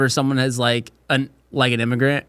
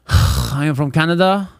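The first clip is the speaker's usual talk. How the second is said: put-on French Canadian accent; 3 semitones higher, 4 dB louder than usual. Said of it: "all from fucking Quebec".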